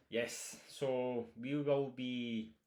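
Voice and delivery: normal talking voice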